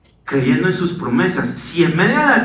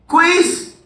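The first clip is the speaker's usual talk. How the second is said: sudden much higher sing-song teacher call; spring the quiz like a teasing teacher; mock teacher; 11 semitones higher than usual. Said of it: "el profesor dice".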